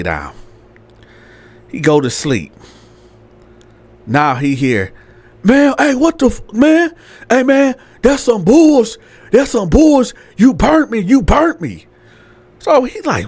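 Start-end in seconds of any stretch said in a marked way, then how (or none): 5.4-11.9 high-pitched mock outraged complaint; arguing girl's voice. Girl accusing someone of burning her, indignant. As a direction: act out a woman protesting in an argument. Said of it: "that was that girl voice".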